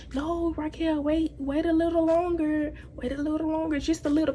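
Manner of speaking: lightly voiced mother calming her daughter; mom soothing. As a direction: coax her gently to hold on. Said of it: "mom was like".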